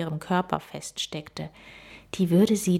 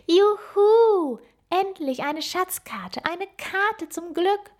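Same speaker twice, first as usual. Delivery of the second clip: much higher and louder whoop of delight; loud joyful cry; 11 semitones higher, 3 dB louder, slower than usual. A narrator's reading. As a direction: jump up cheering for the treasure map. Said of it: "rief laut".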